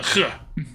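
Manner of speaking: throaty hawked "huh"; hawking sound. Shown as a demonstration. Streamer showing how to hawk it up, throaty huh. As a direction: scrape the h from the back of the throat. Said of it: "you gotta be able to".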